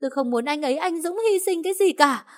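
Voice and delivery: hoarse, very high sobbing scream; woman held back by police, screaming hoarsely through sobs; hoarse crying wail. Acted. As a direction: howl like a caged animal, crying as she begs. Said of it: "tiếng gào khản đặc như con thú bị giam cầm, vừa khóc vừa nói".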